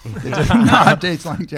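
slow, amused repeat of the line; laughing. Real, not acted. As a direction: laugh through it, drawn out.

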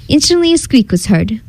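plain reading voice

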